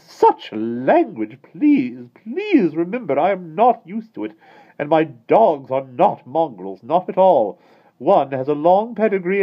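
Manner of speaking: very high, loud, slow indignation; prim lady scolding the admiral; offended prim lady. A narrator's reading. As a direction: huff about it. Miss Lark offended.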